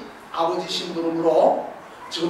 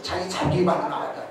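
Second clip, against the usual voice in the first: usual speaking voice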